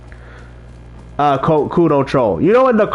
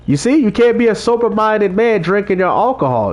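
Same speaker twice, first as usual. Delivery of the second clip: ordinary talk, nothing marked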